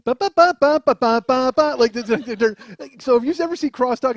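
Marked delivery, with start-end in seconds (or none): 0.1-1.8 loud, high "ba ba" theme; imitated show music. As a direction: belt out the outro jingle in nonsense syllables. Imitates game show outro music.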